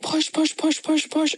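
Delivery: high urgent chant of push; urgent push commands. Retold or imitated. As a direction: urge her on, pitch up high. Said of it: "they were telling me, they were like".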